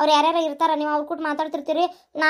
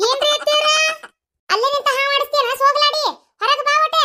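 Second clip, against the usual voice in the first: nagging mother-in-law; 10 semitones higher, 4 dB louder than usual. Acted. Mother-in-law scolds from outside.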